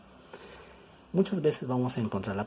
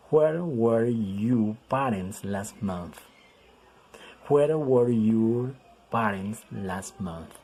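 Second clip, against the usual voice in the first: drawn-out, word by word; teacher reading an example very slowly; very slow model reading; 4 dB louder, much slower than usual. Shown as a demonstration. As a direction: stretch each word for learners.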